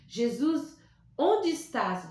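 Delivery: ordinary delivery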